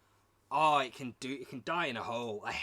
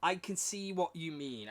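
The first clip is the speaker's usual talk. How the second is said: ordinary talk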